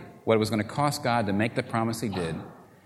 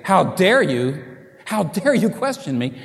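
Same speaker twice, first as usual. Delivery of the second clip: mock angry God; 5 semitones higher, 5 dB louder than usual.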